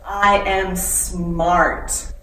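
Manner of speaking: slow, loud affirmation; loud and powerful. Shown as a demonstration. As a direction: proclaim it for the kids to repeat. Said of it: "loud and powerful".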